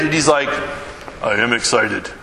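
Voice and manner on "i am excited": lower, deadpan claim; lightly voiced unenthusiastic man; subdued guy. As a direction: say it with no excitement at all. Quiet guy claims to be excited.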